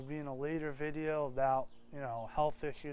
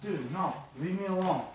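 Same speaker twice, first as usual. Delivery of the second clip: put-on belligerent drunk; 4 dB louder than usual. Stuffed snake refuses football.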